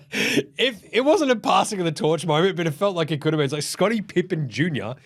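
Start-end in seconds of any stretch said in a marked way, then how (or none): none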